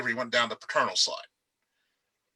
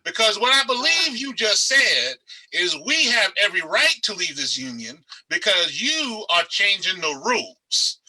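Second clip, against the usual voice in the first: put-on higher, louder, slow Southern accent with laughter breaking through; hammed-up Southern accent, playing an antebellum slave owner; 7 semitones higher, 4 dB louder, slower than usual. To a classmate in Southern history class, a mock Southern accent, higher and louder. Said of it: "I broke into my best Southern accent".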